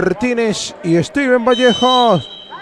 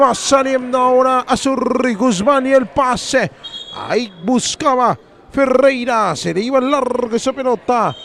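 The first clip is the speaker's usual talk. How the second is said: normal talking voice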